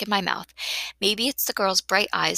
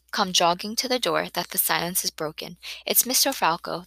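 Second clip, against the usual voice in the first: plain reading voice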